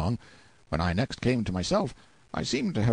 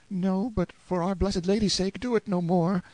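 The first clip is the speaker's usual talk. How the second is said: put-on high boy's voice, pleading anxiously; fearful begging; 10 semitones higher than usual. A narrator's reading. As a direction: implore in fright, pitched well up. Terrified boy begs him to stop.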